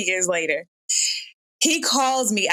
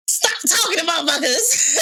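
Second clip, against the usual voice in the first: higher, laughing plea; laughing exasperation; 9 semitones higher than usual. Retold or imitated. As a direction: laugh while you plead.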